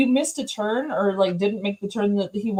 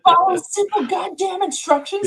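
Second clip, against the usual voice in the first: Samuel Jackson; 9 semitones higher than usual. Retold or imitated. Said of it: "Samuel Jackson".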